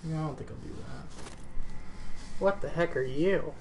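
ordinary delivery

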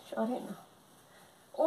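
very slow, soft lullaby hush; drawn-out comforting shush; soothing hush. Acted. Mother soothing her crying baby, very slow and soft.

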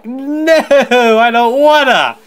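high, screamed whining protest; tantrum yelling. Real, not acted. Player refusing another fetch quest, loud high tantrum.

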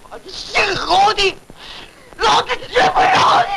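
very high, drawn-out vengeful yell; furious shout. Acted. Tenant vowing revenge on the landlord, furious shout. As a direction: scream the threat at full pitch.

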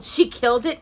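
usual speaking voice

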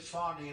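"Fani" said FAH-nee with a little French accent; French-style pronunciation. Shown as a demonstration. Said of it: "a little French accent there".

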